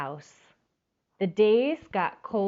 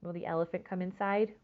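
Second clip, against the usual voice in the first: plain reading voice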